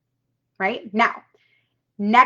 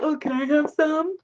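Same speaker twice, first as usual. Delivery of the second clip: mocking mooch; 5 semitones higher than usual. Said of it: "they're like".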